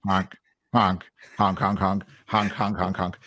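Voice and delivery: playful goose honking; silly goose honks. Pretend. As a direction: honk like a cartoon goose.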